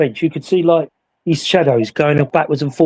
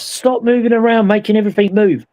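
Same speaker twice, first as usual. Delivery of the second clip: mild mock shout; 6 semitones higher than usual. Matt telling the group to stop moving, pitched-up mock shout. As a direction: complain up high at the others. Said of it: "he shouted at us".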